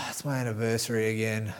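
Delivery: low sighing grumble, a lightly voiced bored husband; grumbling fed-up husband. Husband in the pub moaning about his anniversary, low sighing grumble. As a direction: sigh it out like a man dreading the evening. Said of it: "he says".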